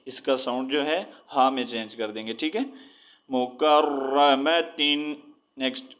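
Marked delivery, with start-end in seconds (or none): 3.3-5.0 مکرمتن read slowly through to -tin; slow Quran-lesson مکرمتن, ending on a held -tin; full ending -tin. Shown as a demonstration. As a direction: sound the final ta in مکرمتن as "tin". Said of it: "مکرمتن ہی ریڈ کریں گے".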